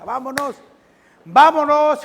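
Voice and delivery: calling out vámonos high and loud; rally the flock, high and loud; shepherd calling sheep. Acted. Preacher voicing shepherd to his sheep, loud high call.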